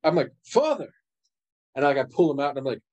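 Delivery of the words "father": high, startled call of his name; surprised cry. Acted. Son finding his father in the closet, startled. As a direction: blurt it out in shock.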